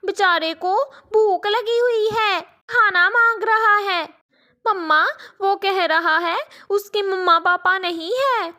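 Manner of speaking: an eager daughter reporting to her mother; little girl. Acted.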